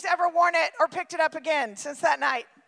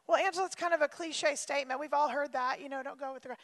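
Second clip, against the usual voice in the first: quick, soft, offhand objection; mock dismissive listener; 3 semitones lower, 7 dB quieter, faster than usual. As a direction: brush it off as a cliche. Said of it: "imagined listener objecting".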